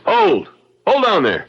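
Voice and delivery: sharp, high shout to halt; commanding call. Acted. Lincoln calling out to a prowler with a pistol, a commanding shout. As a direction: call out to stop him in his tracks.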